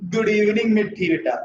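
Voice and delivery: usual speaking voice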